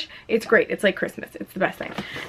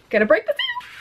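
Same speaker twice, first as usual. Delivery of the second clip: much higher, breathless with excitement; squeaky little thrill; giddy announcement; faster than usual. Real, not acted. Opening package excitedly.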